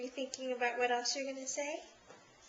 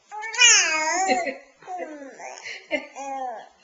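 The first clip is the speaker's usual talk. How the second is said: loud laugh; 3 semitones higher, 10 dB louder than usual. Real, not acted. Parent cracking up at the baby's chatter, loud laugh. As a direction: burst out laughing, near tears.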